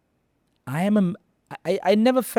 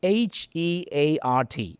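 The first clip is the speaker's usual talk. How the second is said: ordinary delivery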